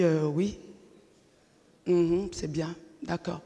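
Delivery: soft, fading reluctant yes; resigned murmur. Retold or imitated. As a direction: give in quietly, hiding disappointment. Réponse résignée à sa mère. (petit oui résigné et de plus en plus bas)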